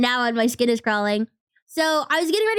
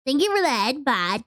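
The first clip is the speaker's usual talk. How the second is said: ordinary talk, nothing marked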